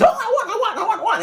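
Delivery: very high, squealing want; shrieking excitement. Retold or imitated. Wife squeals at the slot machine win. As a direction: squeal like a giddy winner.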